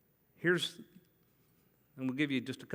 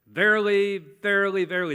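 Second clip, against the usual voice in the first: verily said louder, stressed each time; emphatic repetition; 4 semitones higher, 8 dB louder, faster than usual. Shown as a demonstration. Preacher showing old Bible emphasis, louder. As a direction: hit verily, verily, verily. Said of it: "That's the way of emphasis".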